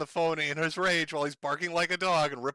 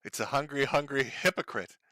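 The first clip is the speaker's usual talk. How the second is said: normal talking voice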